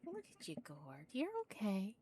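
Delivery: high, soft cooing to a cat; pet talk. Real, not acted. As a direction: reassure the kitten sweetly. Soothing the cat.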